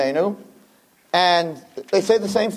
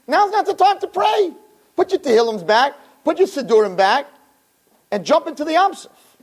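high, pressing retold yell; God yelling at Moshe to stop praying; 10 semitones higher than usual. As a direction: shout the command to jump in. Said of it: "And Hashem's yelling at Moshe".

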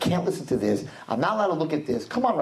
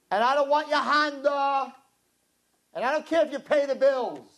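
exasperated wife; 9 semitones higher than usual. Retold or imitated. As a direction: let the frustrated wife vent, pitched up. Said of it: "she always says the same thing".

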